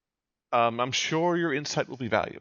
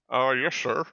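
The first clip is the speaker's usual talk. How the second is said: butler voice. Acted. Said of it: "how do I do a butler voice?".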